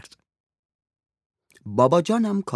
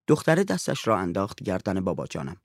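ordinary delivery

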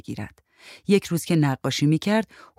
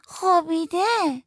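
put-on high, drawn-out little-kid voice; insistent small child; slower than usual. A narrator's reading. Child insists with head raised. (صدای نازک و کشیدهٔ بچهٔ لجباز)

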